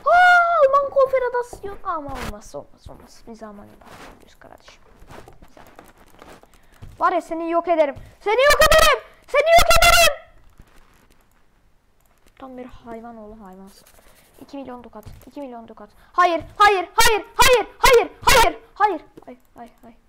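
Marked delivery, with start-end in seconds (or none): 0.1-1.8 loud, high cry fading off; high loud exclamation; startled outcry. Real, not acted. Cries out during game. 7.0-10.2 loud threat building to a high scream; from raised voice to full scream; escalating threat. Real, not acted. 16.1-19.0 high, loud repeated yelling; rapid-fire screamed refusal; frantic shouting. Real, not acted. Frantic refusal during game.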